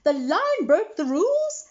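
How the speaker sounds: high, loud tattling call; urgent calling. A narrator's reading. McBee rushes to report the lion, calling high and loud. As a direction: call out the news breathlessly. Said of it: "he called as he walked".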